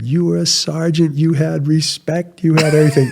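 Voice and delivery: put-on high-pitched protesting grandfather, Portuguese accent. Grandfather scolding grandson over becoming an officer, thick Portuguese accent. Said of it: "He had a really thick Portuguese accent".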